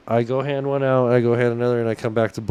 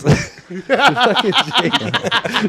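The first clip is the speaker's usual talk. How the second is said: laughing; 7 semitones higher, 5 dB louder, much slower than usual. Retold or imitated. Laughing imitation of Jake.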